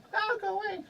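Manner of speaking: tiny, very high-pitched character voice; high squeaky voice. Acted. Shooing someone away over sea anemones, very high squeaky voice. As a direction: squeak it out far above your own voice.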